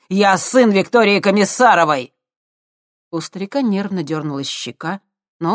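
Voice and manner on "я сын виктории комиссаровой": proud shout. Acted. Boasting about his mother.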